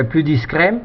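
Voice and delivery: usual speaking voice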